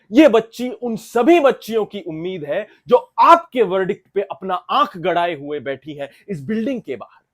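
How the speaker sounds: thundering advocate's appeal, pitched high; impassioned plea. Acted. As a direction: let the closing argument ring out at full force. Impassioned climax of courtroom closing argument.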